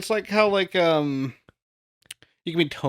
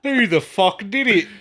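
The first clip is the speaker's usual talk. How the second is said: higher-pitched Cockney outburst; mock Cockney; 4 semitones higher, 4 dB louder than usual. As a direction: demand it in put-on Cockney. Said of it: "turning Cockney".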